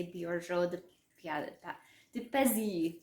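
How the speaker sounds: Borgo de Pazzi in a French accent, Pazzi as peh-ZEE. Shown as a demonstration. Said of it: "with French accent".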